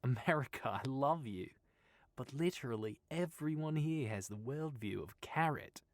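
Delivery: put-on light Australian accent, teasing; joking Australian voice. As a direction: poke fun at America lightly. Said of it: "joked Sylvia".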